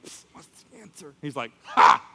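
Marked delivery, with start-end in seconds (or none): 0.0-1.1 hushed whisper to a classmate; secretive stage whisper; furtive whisper. Retold or imitated. Secretly asks friend in class. 1.7-2.0 single loud "ha" burst; one sharp, loud laugh at someone; imitated scornful ha; mocking laugh. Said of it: "He just laughed at me".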